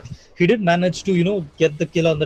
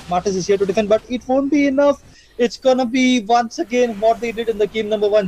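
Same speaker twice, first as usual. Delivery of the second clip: high-pitched match commentary; excited play-by-play; 6 semitones higher than usual. Real, not acted. Caster calls the decisive push to the viewers, excited and high. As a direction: pitch rising high as the attack builds.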